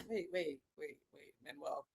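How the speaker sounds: very soft, trailing-off plea; hushed attempt to halt him; fading protest. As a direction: let the words shrink to almost nothing. Story, stopping him under her breath.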